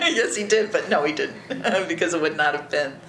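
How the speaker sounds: laughing through the words; amused, laughing answer. Real, not acted. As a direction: say it while still laughing. Laughs at own joke.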